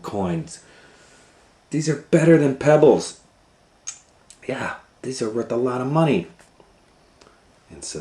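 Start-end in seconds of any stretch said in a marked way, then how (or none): 1.7-6.3 high, loud delight at treasure; thrilled children; excited. Acted. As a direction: gush over the gems.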